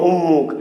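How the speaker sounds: "উম্মুখ" with doubled m; spoken form. Shown as a demonstration. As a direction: say "উম্মুখ" as um-mukh, no n. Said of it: "কিন্তু উচ্চারণ হবে কি".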